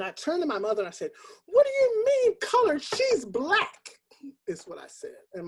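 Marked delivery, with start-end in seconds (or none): 1.4-4.0 much higher and louder, indignant outburst; enraged. Retold or imitated. Daughter correcting her mother's word, enraged. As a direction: snap back at mother in anger. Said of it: "I became enraged".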